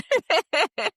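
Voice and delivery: loud, high-pitched laugh. Real, not acted. Guest amused after pitching her offer, laughing. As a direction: let out a big high laugh.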